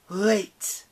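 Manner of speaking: loud, high panicked call. Acted. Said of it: "Nyx called, suddenly panicked".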